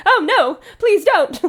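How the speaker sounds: real laugh breaking through the words; laughing protest at herself. Real, not acted. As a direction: giggle your way through it. Laughing at her own delivery.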